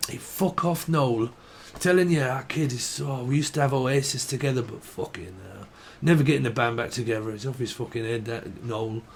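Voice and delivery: put-on muttered Manchester accent, trailing off softly; offhand mock-Mancunian rant. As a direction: grumble about a brother like a Mancunian.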